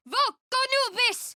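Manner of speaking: very high ringing spell cry; soaring incantation. Acted. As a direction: summon the storm with one cry. Casting a spell in combat.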